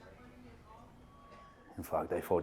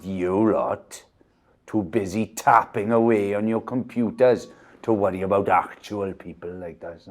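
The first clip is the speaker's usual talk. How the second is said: louder, accusing rant; loud telling-off; 10 dB louder than usual. Acted. Victor berating staff at their computers, loud. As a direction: lay into the staff.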